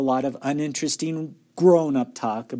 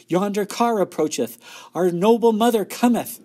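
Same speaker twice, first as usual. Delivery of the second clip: put-on high, lofty old-time announcement; grand storybook proclamation; 9 semitones higher, 5 dB louder than usual. A narrator's reading. Older sister showing off book talk, grand proclamation. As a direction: proclaim it like a herald in an old tale. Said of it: "People talked like that in the books".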